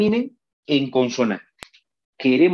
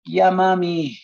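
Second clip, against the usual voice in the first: higher, drawn-out reply to mother; child answering mum; 4 semitones higher, slower than usual. Acted. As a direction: play the obedient kid calling back. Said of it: "imagínate que tu mamá te diga".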